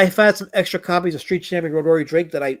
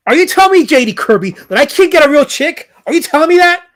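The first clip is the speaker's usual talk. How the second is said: loud, high mock indignation at the chat; hammed-up offended protest, pushed high; mock outrage; 9 semitones higher, 7 dB louder than usual. Streamer protesting to a chatter, hammed-up mock outrage.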